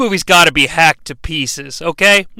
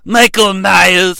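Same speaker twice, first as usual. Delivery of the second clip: lightly voiced slow solemn doctor; Doctor Loomis voice; much slower than usual. Loomis giving a speech, slow solemn name. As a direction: intone the name slowly. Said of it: "may become Doctor Loomis".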